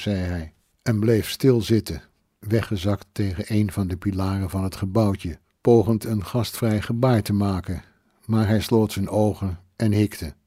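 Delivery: ordinary delivery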